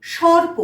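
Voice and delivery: teacher modelling সর্প with a clear র; model pronunciation. Shown as a demonstration. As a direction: sound র clearly before প in সর্প. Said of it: "তার আগে র উচ্চারণ করলাম".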